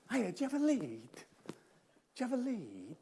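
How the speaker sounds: drawn-out, softening acted request; mock desperate plea. Audience member begging around for a phone lead, mock desperate. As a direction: stretch the last question out slowly and quietly. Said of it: "you know, going".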